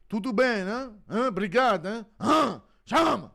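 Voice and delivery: high, loud Brazilian fan voice, shouting out Portuguese; hyped mock Brazilian. Reading out a Brazilian donation, loud hammed-up Brazilian shouting. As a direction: yell it like an overexcited Brazilian fan.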